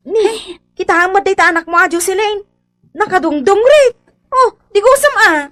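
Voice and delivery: loud, high, fast scolding; mother shouting at dirty children to bathe; scolding the kids. Acted.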